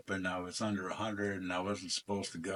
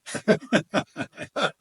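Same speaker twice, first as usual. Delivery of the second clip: loud high burst of laughter; real laugh; 11 semitones higher, 11 dB louder than usual. Real, not acted. Laughing at his own brag to the coach, a loud high laugh.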